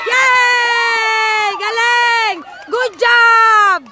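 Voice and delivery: drawn-out, delighted shout; real spectator whooping praise; cheering. Real, not acted. Cheering a good play.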